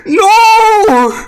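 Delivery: high, crying "no" of despair; theatrical high-pitched heartbreak; anguished wail. Real, not acted. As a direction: wail as if all hope is lost. Gamer's hopes dashed by the pull.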